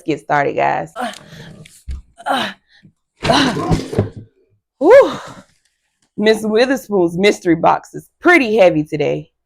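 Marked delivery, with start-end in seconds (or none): 4.8-5.2 a big, high, loud whew of effort; hammed-up phew after lifting; relieved whew. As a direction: blow out like the box is heavy. Teacher lifting heavy mystery box.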